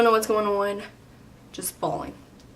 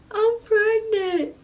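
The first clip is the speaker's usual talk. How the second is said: high, slow tearful confession; imitated bawling; 12 semitones higher, much slower than usual. Daughter telling her mom the news, dramatic crying. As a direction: draw it out in a mock-crying wail. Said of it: "I'm bawling".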